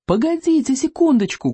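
high-pitched call to wait; crying out. A narrator's reading. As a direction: call out urgently, pitched well up. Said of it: "крикнул Экинг". (высокий окрик)

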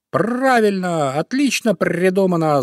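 high, drawn-out cry of approval; excited young crow. A narrator's reading. Little crow cheers the old raven's plan, crying out. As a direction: cry out with long stretched sounds. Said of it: "закричал воронёнок Боря".

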